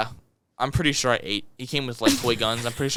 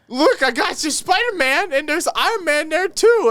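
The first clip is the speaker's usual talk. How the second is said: mom voice; 3 dB louder than usual. Retold or imitated. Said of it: "she was like".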